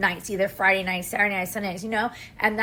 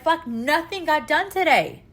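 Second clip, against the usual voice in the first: higher-pitched imitated frustration; retold exasperation; 6 semitones higher than usual. Tired mom venting after the kids come home, exasperated. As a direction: replay end-of-day exasperation, pitched up. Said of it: "I'm just like".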